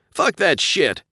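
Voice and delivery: high, loud angry refusal; angry insisting. A narrator's reading. Pilot refusing the co-pilot, angry. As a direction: snap it back hard. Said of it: "Josh insisted".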